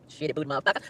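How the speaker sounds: low, very soft swearing; muttered curse. Real, not acted. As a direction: mutter it under your breath, low. Cusses under her own breath.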